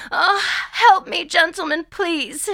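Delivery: high, pained little plea; small voice. A narrator's reading. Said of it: "she says in a small voice".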